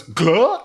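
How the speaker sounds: a sudden startled gah as he drops his gum. Retold or imitated. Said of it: "he goes".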